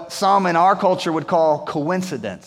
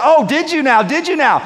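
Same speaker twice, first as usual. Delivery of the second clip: high, loud, mock-surprised threat; ominous sarcastic royal reply; menacing king; 8 semitones higher, 6 dB louder than usual. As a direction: let the anger boil under the question. King learns Haman's plot.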